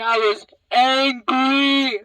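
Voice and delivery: loud, drawn-out stress on "angry"; emphatic angry. Retold or imitated. Storyteller stressing her anger at mom, emphatic angry. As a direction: stretch out "angry" and belt it. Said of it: "And I was mad".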